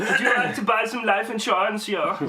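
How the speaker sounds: hammed-up German accent, selling insurance; mock German accent. Joking with friends as a German salesman, mock accent. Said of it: "I thought it was German".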